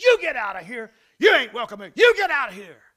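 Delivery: loud mock yell, sharply punched openings; retold hollering. Guide yelling at a grizzly to leave, retold hollering. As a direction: holler as if driving off an animal. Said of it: "I went to hollering and screaming".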